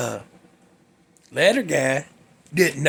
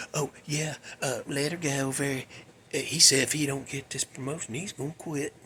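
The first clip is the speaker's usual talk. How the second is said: softer, offhand rumor-spreading; mock casual gossip; 4 semitones lower, 12 dB quieter than usual. Jerk guy telling people about a coworker, mock casual gossip. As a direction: drop the voice and slip it in casually.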